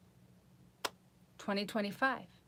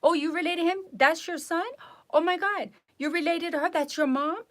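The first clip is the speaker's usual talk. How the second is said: high-pitched, mock-astonished nurse; mocking imitation, excited and pitched way up; stupid nurse; 9 semitones higher, 4 dB louder than usual. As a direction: gush each question like a clueless nurse. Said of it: "The stupid nurse was like that. They're like".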